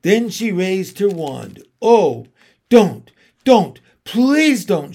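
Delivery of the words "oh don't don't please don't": louder, desperate pleading shout. A narrator's reading. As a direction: beg her to stop. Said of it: "shouted Edmund".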